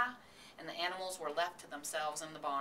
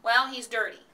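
insisting; 5 semitones higher, 11 dB louder than usual. A narrator's reading. Wife insisting in a quarrel.

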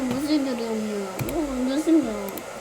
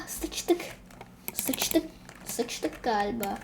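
much softer, breathless murmur; whispered dread; 3 semitones lower, 12 dB quieter, slower than usual. Real, not acted. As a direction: whisper it as it all goes wrong.

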